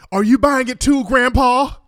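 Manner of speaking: high, loud mocking cry to the judge; mock shouted plea. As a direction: yell it up at Grandpa like a whiny kid. Mocking defendant addressing judge.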